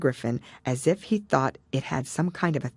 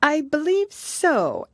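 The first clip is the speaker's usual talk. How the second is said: put-on high, slow girlish voice, musing; Alice thinking it over, pitched high; thoughtful Alice; 13 semitones higher, 4 dB louder, slower than usual. A narrator's reading. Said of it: "Alice replied thoughtfully".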